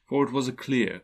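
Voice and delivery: usual speaking voice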